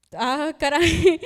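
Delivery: slow, loud dismayed exclamation; a stunned, drawn-out gasp of dismay; stunned ah caray. Retold or imitated. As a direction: let it land in slow disbelief. Retelling shock at father leaving.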